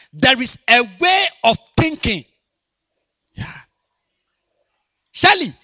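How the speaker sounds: fiery sermon shout; preaching at full force. Acted. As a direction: hammer it home to the church. Preaching to congregation.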